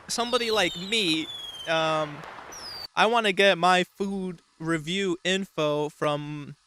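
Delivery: ordinary talk, nothing marked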